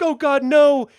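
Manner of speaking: high, loud terrified cry; doomed crew begging, high and loud; panicked victims. Retold or imitated. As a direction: cry out in desperate fear. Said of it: "these guys are like".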